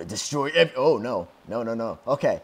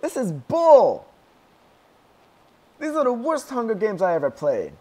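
high, loud whining rant; frustrated outburst; 11 semitones higher, 9 dB louder than usual. Real, not acted. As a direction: complain bitterly at full volume after losing. Ranting after final loss.

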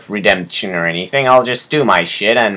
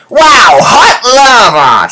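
sudden high yell of surprise at the lava; excited shout; 10 semitones higher, 4 dB louder, much slower than usual. Real, not acted. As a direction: burst out in delighted alarm.

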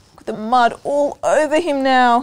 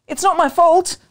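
defensive; 8 semitones higher, 5 dB louder than usual. Acted. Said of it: "I thought".

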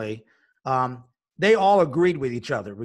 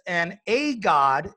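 slow, with "a" drawn out before God; slow mock quote hitting "a"; stress on a; much slower than usual. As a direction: lean on the little word "a". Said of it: "And he says".

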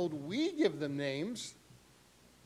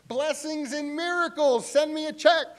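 higher, louder hammed-up preacher voice; mock prosperity preacher; 11 semitones higher, 9 dB louder than usual. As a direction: lampoon a money-hungry TV preacher.

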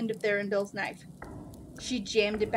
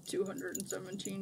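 much softer, sheepish confession; reluctant admission; 8 dB quieter than usual. A narrator's reading. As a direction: own up under his breath. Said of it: "the Jin admitted".

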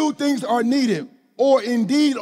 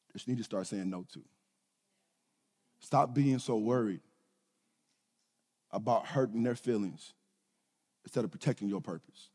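much softer and lower, close and intimate; quiet preaching; 13 dB quieter than usual. Acted. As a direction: lean in and say it softly to each one.

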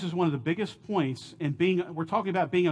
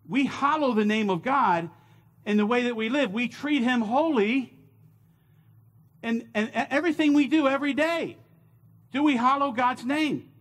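preaching at full force; 6 semitones higher than usual. Acted. Pastor urging the congregation, full preaching fervor. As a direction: preach it, building to a peak.

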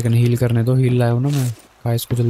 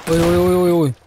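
alarmed calling out; 4 semitones higher than usual. Real, not acted. Under fire, calling out alarmed.

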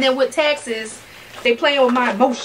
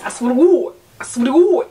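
emphatic; 5 dB louder than usual. Real, not acted. Emphatic oath.

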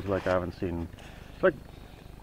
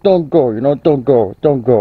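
voicing dog; 3 semitones higher, 12 dB louder than usual. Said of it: "she says, like".

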